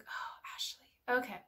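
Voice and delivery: soft, breath-heavy aside to oneself; self-reproach. Retold or imitated. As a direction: sigh it out under the breath. Retold sigh to herself on realizing.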